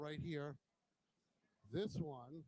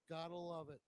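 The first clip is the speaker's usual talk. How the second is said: usual speaking voice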